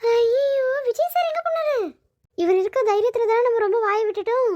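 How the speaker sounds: frantic, searching for her protector; high frightened wail; panicked. Acted. Panics when Vijay is gone.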